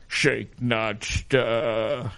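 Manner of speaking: drawn-out suave spy imitation; James Bond. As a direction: stretch the last word like a film spy. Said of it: "that's how he talks".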